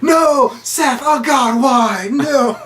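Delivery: high, hammed-up wail of protest; overacted, high-pitched pleading; mock anguish. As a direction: cry out in melodramatic despair, loud on each "No". Franco's acted anguish over Seth.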